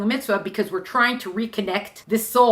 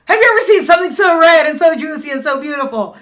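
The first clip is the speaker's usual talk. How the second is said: proud excited farmer; 6 semitones higher, 8 dB louder than usual. Acted. Farmer praising tomatoes.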